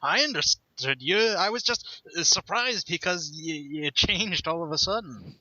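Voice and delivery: high, hurried protesting; flustered protest. Retold or imitated. Naruto flustered, reassuring the old man, high and hurried. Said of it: "He's like".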